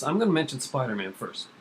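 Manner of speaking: ordinary talk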